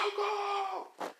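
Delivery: long, very high acted scream of a name; high-pitched drawn-out cry; calling out. Friend running down, calling out.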